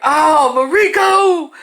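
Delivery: loud, high, slow crowd groan. Retold or imitated. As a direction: stretch it out like the whole team watching the catch. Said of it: "now everybody like".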